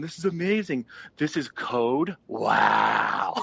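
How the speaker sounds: drawn-out, awestruck imitation; mock fanboy excitement. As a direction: gush over it like a giddy fan. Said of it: "all excited and going".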